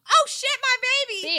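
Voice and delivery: high, loud mock panic; panicked cry. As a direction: shriek it like a horrified mother, playfully. Acting out the mother's panic.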